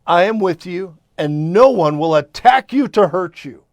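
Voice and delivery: booming, declaring God's promise; loud proclamation. Acted. Preacher to congregation, loud booming proclamation.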